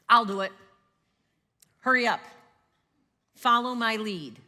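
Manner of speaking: loud, curt orders barked out; bossy commands. Retold or imitated. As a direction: take charge and order everyone around. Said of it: "bold, bossy, confident, or controlling words".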